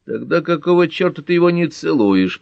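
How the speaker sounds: annoyed, grumbling outburst; grumbling challenge. A narrator's reading. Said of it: "проворчал из темноты мужской голос".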